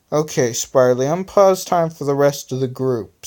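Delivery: ordinary delivery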